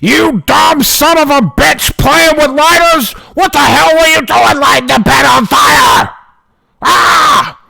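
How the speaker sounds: dad storming in screaming at his son; angry dad yelling. Retold or imitated. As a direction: blow up at your kid like an enraged dad. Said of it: "my dad storms in".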